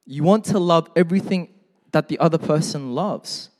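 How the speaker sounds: normal talking voice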